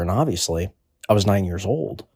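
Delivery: usual speaking voice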